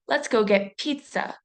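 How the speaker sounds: slow, with a hard burst of air on the P of "pizza"; overemphasized P. Shown as a demonstration. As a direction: pop the first consonant of "pizza" with exaggerated force. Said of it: "notice my emphasis on the P consonant".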